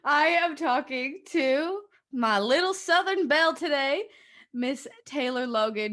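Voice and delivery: podcast host introduces her guest in a mock Southern US accent. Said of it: "I got my Southern accent on".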